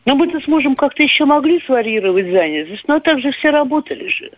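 usual speaking voice

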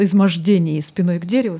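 usual speaking voice

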